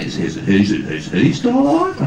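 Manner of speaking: stammering attempt at a New Zealand accent, rising high and slow. Interviewer asks after another actor, imitated New Zealand accent. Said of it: "whether I can do the accent".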